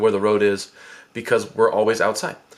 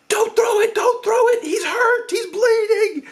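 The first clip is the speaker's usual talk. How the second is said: much higher, louder desperate yelling; panicked yelling; 3 dB louder than usual. Retold or imitated. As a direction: plead at full shriek to stop her. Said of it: "I'm just like".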